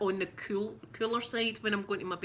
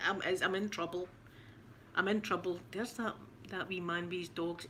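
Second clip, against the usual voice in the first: ordinary delivery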